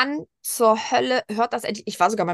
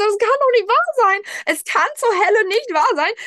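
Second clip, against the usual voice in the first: much higher and louder, shocked disbelief; ungläubiger Ausruf; 11 semitones higher, 5 dB louder than usual. Retold or imitated. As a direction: cry out in astonishment as your nose clears. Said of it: "Ich so". (hoch und laut, fassungslos: das kann doch nicht wahr sein)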